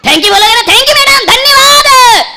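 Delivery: high loud gushing thank you; effusive thanks. Retold or imitated. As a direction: gush gratitude to a stranger. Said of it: "अरे जोर से बोलना".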